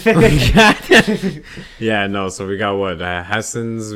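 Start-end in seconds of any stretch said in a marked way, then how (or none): 0.0-1.4 words broken up by laughter, high and slow; laughing. Real, not acted. Host cracking up over beating listeners at geography, laughing. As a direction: laugh through the line.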